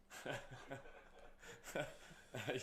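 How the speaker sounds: airy wheezing laugh; breathy silent laugh. Real, not acted. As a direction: laugh under the breath at the absurdity. Laughing at the rude lady.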